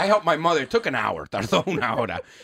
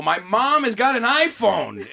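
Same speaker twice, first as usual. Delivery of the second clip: drawn out slowly, half disbelieving; mock-stunned slow announcement; slow disbelief; 8 semitones higher, 4 dB louder, slower than usual. Real, not acted. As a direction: lay each word out like unbelievable news. Disbelief at mom's iPhone.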